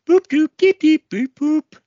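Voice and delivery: very high, loud electronic boops; beeping phone tones. Acted. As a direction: sing out the machine beeps. Answering machine dialing tones.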